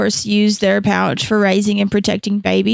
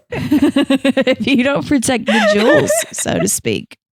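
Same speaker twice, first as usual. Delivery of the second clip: laughing through it; 4 semitones higher, slower than usual. Real, not acted.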